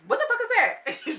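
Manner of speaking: loud mock disbelief; shocked outburst. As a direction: blurt it out in shock. [name] reacts to smell comment.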